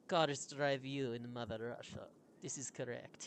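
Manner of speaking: put-on Russian accent. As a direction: confirm the joke in a Russian accent. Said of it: "Is that your Russian accent".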